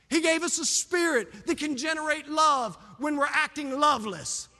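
loud, rising fervent sermon, pushing each phrase; preaching at full force. Acted. Pastor rousing the church against fear, loud and fervent. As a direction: preach it to the congregation at full power.

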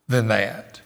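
usual speaking voice

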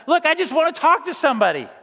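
fed up with the phone robot; exasperated caller. Retold or imitated. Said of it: "I say".

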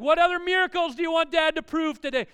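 loud, high sarcastic rant; mock outburst of a dad at wit's end; exasperated dad. Dad to demanding kids at breakfast, exasperated loud rant.

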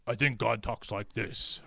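low, slow put-on God voice; mock-solemn deep God voice; assumed God voice. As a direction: drop low and draw it out as God. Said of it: "you make some assumptions, like".

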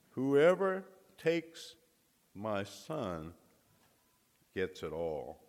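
grave slow pronouncement sinking at the end; solemn slow emphasis. Acted. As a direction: proclaim it slowly as the Father's words. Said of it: "God the Father is saying to us".

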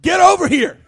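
half-joking mama order to come now; stern command. Retold or imitated. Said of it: "It's more like".

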